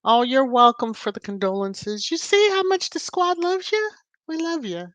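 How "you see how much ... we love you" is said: high, sing-song reassurance; affectionate. Real, not acted. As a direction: gush love at the viewer. Host reassuring viewer of love.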